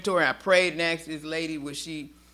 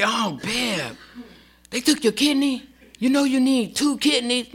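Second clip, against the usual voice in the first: shocked worried friend; 6 semitones higher than usual. Retold or imitated. People's shocked reaction.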